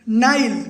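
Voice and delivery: नाइल ending on the L sound, no vowel after it; silent final E in नाइल. Shown as a demonstration. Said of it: "ई का sound नहीं आएगा". (नाइल, अंत का ई silent)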